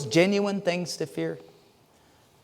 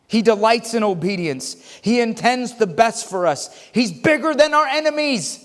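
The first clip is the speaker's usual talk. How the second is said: high, emphatic pulpit proclamation; full-force preaching; 6 semitones higher than usual. Acted. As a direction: proclaim each phrase with rising conviction.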